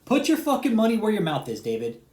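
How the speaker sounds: loud, high-pitched dare shouted at David; angry challenge. Real, not acted. Ranting at David over his channel, a loud angry dare. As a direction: call him out, full voice.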